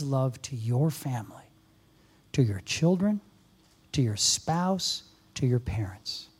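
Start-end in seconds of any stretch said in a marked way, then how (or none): none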